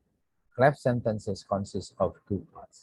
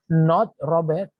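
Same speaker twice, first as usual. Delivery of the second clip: NOT raised, louder and punched; contrastive stress; 6 semitones higher, 6 dB louder than usual. Shown as a demonstration. Teacher to class, stressing the contrast. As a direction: hit "Not" hard to rule Robert out.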